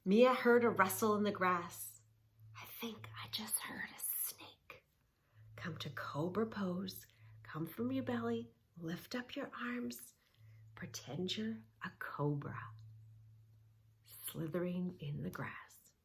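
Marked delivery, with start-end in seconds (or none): 2.6-4.8 hushed whisper, barely a sound. Acted. Mia warning her aunt about a snake, whispering. Mia hears rustle in grass. 5.6-15.9 soft, hushed whisper guiding the pose; whispered yoga cues. Acted. Teacher guiding the kids into cobra pose, whispering.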